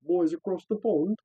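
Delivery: bad British accent. Greeting UK listeners in a hammed-up British accent. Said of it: "That was terrible".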